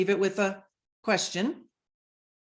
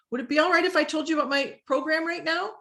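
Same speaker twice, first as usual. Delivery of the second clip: higher and quick, asking politely with a lift on right; permission-asking pitch; 7 semitones higher, faster than usual. Shown as a demonstration. Coach demoing how a seller asks permission. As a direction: sound like you are asking, not pushing. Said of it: "We're always asking permission".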